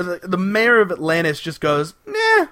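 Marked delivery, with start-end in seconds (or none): 2.1-2.5 high, offhand brush-off; dismissive. Retold or imitated. Mayor waving away the doctor's warning, dismissive. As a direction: toss it off as a breezy, high "nah".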